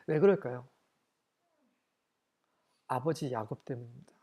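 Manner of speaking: ordinary talk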